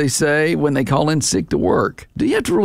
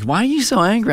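higher-pitched mock challenge; calling someone out; 7 semitones higher than usual. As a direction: imitate someone questioning your temper. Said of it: "they'll call you out like".

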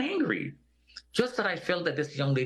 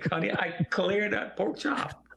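higher, slow, laughing through it; 8 semitones higher than usual. Real, not acted. Telling the listener he finished lunch, laughing. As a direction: let the laugh carry the words. Laughing at his own story.

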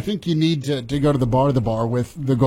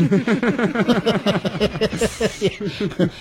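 long, higher-pitched run of laughter; hearty laugh; 5 semitones higher than usual. Real, not acted. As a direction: laugh on and on at the caller's joke. Reacts to caller's joke about rules.

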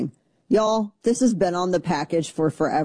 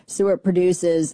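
normal talking voice

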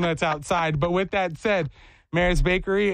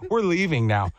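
ordinary talk, nothing marked

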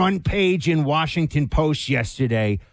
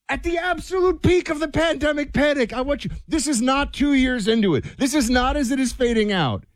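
outraged; 8 semitones higher than usual. Real, not acted. Outraged rant about pandemic timing.

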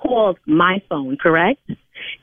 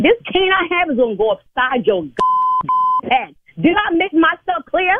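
raised pitch, furious telling-off; angry threat; 5 semitones higher than usual. Real, not acted. Church signer pushed too far by caller, angry threat. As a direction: lose your patience and threaten the caller.

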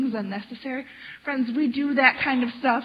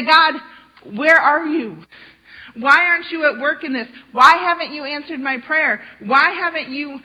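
louder frustrated prayer, dragging the words; impatient complaint to God; 3 semitones higher, 7 dB louder than usual. Retold or imitated. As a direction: whine at God like you've waited too long.

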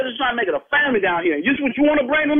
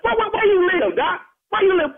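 heated challenge; 7 semitones higher than usual. Real, not acted. Confronting the complaining neighbour.

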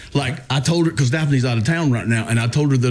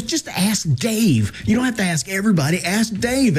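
higher, emphatic imitation of an insistent wife; 6 semitones higher than usual. Wife telling husband to ask Dave, insistent. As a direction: press the obvious solution as the retold wife. Retold wife urging the obvious fix.

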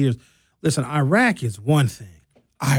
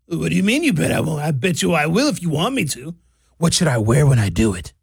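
mock Trump. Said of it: "Trump answers Netanyahu's dare".

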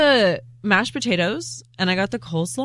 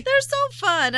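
high, slow, gushing delight; drawn-out squeal of delight at the electronic ponies; slower than usual. Real, not acted. Coworker gushing about the electronic ponies, drawn-out and high. Repeats gush even higher.

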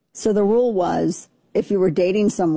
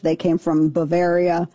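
usual speaking voice

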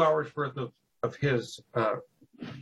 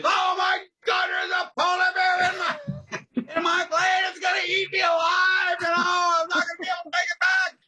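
high frantic yelling, much higher and louder; panicked shout; 4 dB louder than usual. Retold or imitated. Pilot shrieking over the radio about a polar bear.

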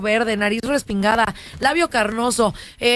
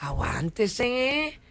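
calming cop; 3 semitones lower, much slower than usual. Retold or imitated. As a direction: slowly soothe her down. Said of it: "Y el policía le dijo".

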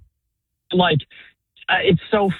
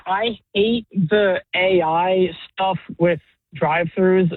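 ordinary talk

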